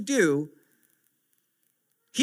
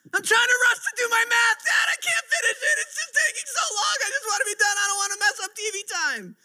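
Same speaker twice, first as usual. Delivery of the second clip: mock crying child; 12 semitones higher than usual. Dad imitating his son crying over unfinished math, high wail. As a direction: wail it like a frustrated kid. Dad voicing son's meltdown.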